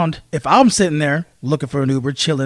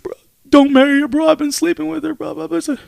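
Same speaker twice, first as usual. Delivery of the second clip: lightly voiced friend, pitched higher, pleading; a hint of a guilty best friend owning up; tearful confessing friend; 9 semitones higher than usual. Best friend confessing at the bachelor party, lightly voiced higher. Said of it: "He's like".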